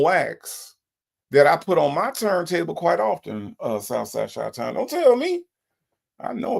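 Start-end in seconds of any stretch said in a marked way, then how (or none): 4.7-6.6 mock protest. Real, not acted.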